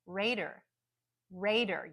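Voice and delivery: raider with strong RAY, weak der; careful raider, stress only on the first syllable; unstressed second syllable. Shown as a demonstration. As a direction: reduce the end of raider to der. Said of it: "it's just der because it's unstressed".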